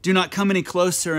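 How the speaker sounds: usual speaking voice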